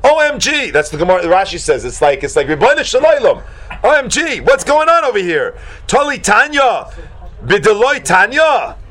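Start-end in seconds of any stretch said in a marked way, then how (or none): 0.0-0.7 high-pitched mock astonishment; hammed-up OMG; shocked. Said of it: "he's, like, shocked". 2.5-8.8 high, stretched-out exclamations; mock-astonished, high-pitched appeal; shocked. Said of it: "he's shocked".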